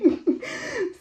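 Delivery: sudden high laugh; a short squeal of laughter. Real, not acted. Laughing to viewers after joking about sex.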